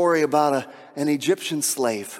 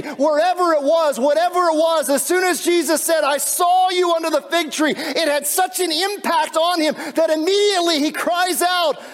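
preaching at full force; 11 semitones higher than usual. Acted. Preacher crying out Nathanael's confession to the congregation, fervent shout. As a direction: build to a fervent shouted peak.

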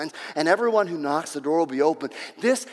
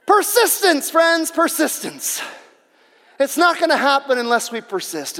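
fervent preaching; 8 semitones higher, 7 dB louder than usual. Real, not acted. Preaches at full force.

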